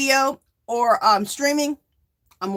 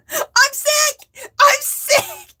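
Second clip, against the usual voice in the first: much higher, louder comic yell; mock shout; 5 dB louder, much slower than usual. Reading a viewer's comment, high laughing shout. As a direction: belt out the commenter's joke gleefully.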